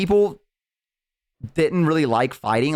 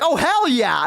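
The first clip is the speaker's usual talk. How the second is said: high, gleeful mock enthusiasm; retold eager relish; 11 semitones higher, slower than usual. Orcs accepting a fight, eager. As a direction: jump at the offer of a fight.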